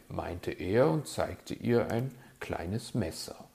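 usual speaking voice

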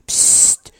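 sharp unvoiced "psst"; hushing. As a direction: shush someone conspiratorially. Hushing Weißohr to keep secret. (zischendes Psst zum Schweigen)

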